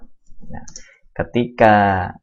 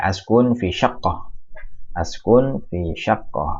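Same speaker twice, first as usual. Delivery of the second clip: slow model reading; slower than usual. Shown as a demonstration. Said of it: "teacher models Arabic sentence".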